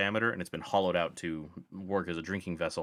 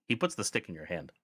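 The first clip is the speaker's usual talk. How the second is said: ordinary delivery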